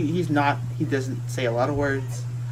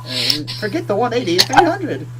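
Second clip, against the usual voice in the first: eager bargaining; 7 semitones higher than usual. Acted. Captured merchant bidding higher to the party. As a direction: pitch rising as the offer grows. Merchant raising his offer.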